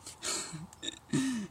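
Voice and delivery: a burst of laughter; short real laugh before the story. Real, not acted. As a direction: laugh at the memory. Laughing while telling school story.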